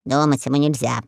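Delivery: low, soft parrot aside. Acted. Parrot to the king, a low snide remark. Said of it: "заметил попугай".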